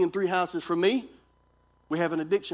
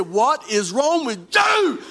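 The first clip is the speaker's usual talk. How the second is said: higher, louder, slow shout; shouted back; 6 semitones higher, 6 dB louder, much slower than usual. Retold or imitated. Pastor yells back at his startled wife in the dark hallway, loud and slow. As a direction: throw the question back at her, yelling. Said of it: "I said".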